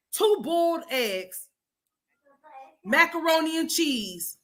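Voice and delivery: high, slow disbelieving list; incredulous listing. Real, not acted. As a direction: spell it out in disbelief. Listing the strange meal.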